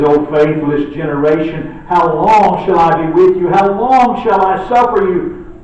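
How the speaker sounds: loud, pleading lament. Retold or imitated. Jesus lamenting to the faithless generation, loud and pleading. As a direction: cry out at the faithless crowd. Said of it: "Jesus is lamenting their lack of faith".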